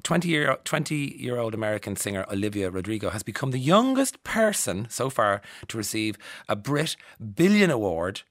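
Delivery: ordinary delivery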